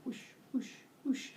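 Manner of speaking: soft, whispery tail swishes; swishing whoosh. Acted. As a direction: breathe out soft swooshes. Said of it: "swishes back and forth".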